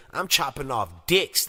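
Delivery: ordinary delivery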